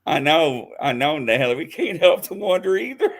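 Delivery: normal talking voice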